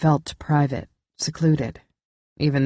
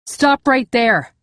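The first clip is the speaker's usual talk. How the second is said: high, loud, slow command to stop; commanding shout; 9 semitones higher, 6 dB louder, slower than usual. Acted. Narrator orders the fleeing intruder to stop, sharp loud command. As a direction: bark it out at the intruder.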